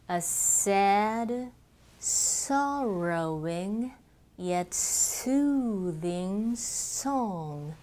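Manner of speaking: every long vowel held very slow and full; long vowels stretched. Shown as a demonstration. Teacher reading the practice sentence with stretched vowels. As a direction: pull each vowel like a rubber band. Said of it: "では、行ってみます".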